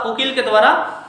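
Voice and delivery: usual speaking voice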